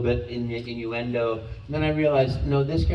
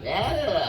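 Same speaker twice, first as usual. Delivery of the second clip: high, wavering mock scream; terrified cartoon yelp; 7 semitones higher than usual. Shown as a demonstration. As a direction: cry out like a scared cartoon character. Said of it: "really terrified".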